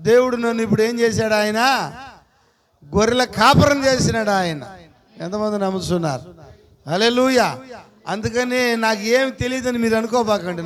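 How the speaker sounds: ordinary talk, nothing marked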